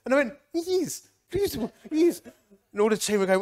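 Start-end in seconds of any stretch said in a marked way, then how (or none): 0.5-2.2 put-on high, eager Welsh-accented yes; mimicked Welsh accent. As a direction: slip into the Welsh accent, keen to please. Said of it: "I sort of mimic their accent".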